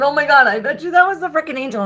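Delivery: high, loud and fast; excited realization. Real, not acted. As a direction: burst out with the discovery.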